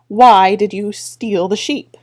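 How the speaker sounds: slow, loud, stern demand; a girl glaring down at thieves, slow and firm. Acted. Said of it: "Tiffany glared at them".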